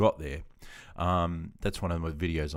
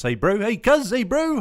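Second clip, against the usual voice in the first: loud, high-pitched playful Kiwi greeting; hammed-up New Zealand accent, calling out high; mock New Zealand accent; 10 dB louder, slower than usual. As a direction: put on a cartoonish Kiwi hail. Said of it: "Oh, you're from New Zealand".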